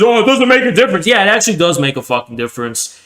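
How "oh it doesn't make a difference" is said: mocking quote. Mocks opponents' dismissal.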